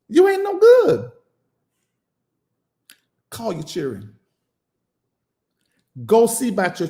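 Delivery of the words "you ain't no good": "You ain't no good" squealed far higher, good stretched out. Acted. To the absent parent, mocking: "You ain't no good" squealed high. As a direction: shriek it like a mocking cry.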